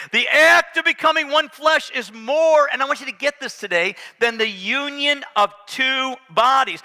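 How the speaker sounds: booming sermon delivery; full-force preaching. Acted. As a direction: preach it out to the whole church.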